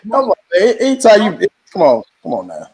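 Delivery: ordinary delivery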